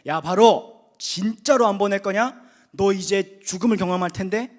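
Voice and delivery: loud, defiant threat; bold warning. Retold or imitated. Preacher voicing bold Moses warning Pharaoh, loud defiant threat. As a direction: stand up to the king and warn him. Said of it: "모세가 담대해져".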